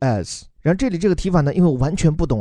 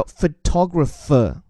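photographer said slowly, stress on the second syllable "tog"; teacher's slow model of photographer; much slower than usual. Shown as a demonstration. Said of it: "一个是重音位置变成了photo那个头".

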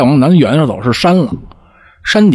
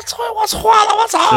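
very high, strained cry of surrender; thin mimicked shriek, not full volume; panicked wail. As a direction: imitate someone howling for mercy. Acts the woman crying out in surrender.

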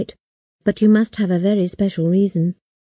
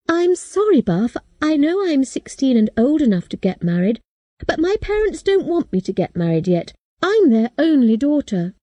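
put-on young girl apologizing, voice pitched up; girl refusing a suitor, higher and hurried; pleading girl, higher; 7 semitones higher than usual. A narrator's reading. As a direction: lift the voice into a nervous teenage girl. Kate politely refuses Buff.

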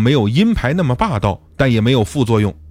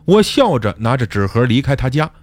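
usual speaking voice

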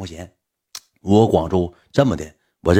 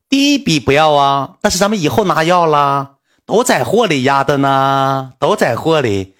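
slow, louder, higher imitation of the assistant; assistant's slow reply; 5 semitones higher, 4 dB louder, slower than usual. Imitating the assistant explaining that money sits in the goods, slow and loud. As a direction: drag it out like the assistant insisting.

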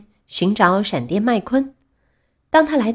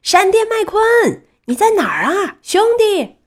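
long, high-pitched searching call; calling out loudly; 11 semitones higher, 4 dB louder than usual. A narrator's reading. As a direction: holler across a crowd for a friend. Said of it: "板牙大声喊着".

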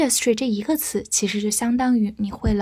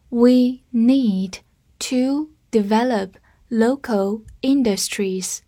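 slow reading; slower than usual. Shown as a demonstration. Teacher reading the sentence slowly with students. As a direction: read it slowly, phrase by phrase. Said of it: "我们慢慢来读".

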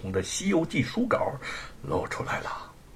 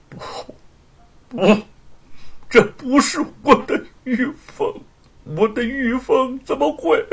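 high, loud, breaking disbelief; anguished denial; 13 semitones higher, 3 dB louder than usual. Acted. Husband over his wife's coffin, crying out in grief.

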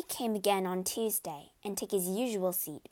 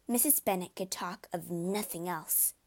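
ordinary delivery

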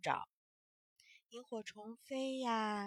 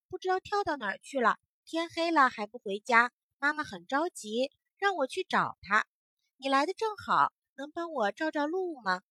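loud, hurried little-creature voice; busy grasshopper; 4 semitones higher, 10 dB louder than usual. Acted. Grasshopper hurriedly asks the firefly for light, loud and quick. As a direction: rush through it, worried about the lost brother. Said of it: "小蚂蚱说".

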